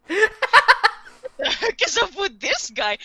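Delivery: high, loud, slowed disbelief; mock bewilderment. Light eyeing the strange man in the exam, mock bewilderment. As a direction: play up exasperated bafflement through laughter. Voicing Light's baffled thought.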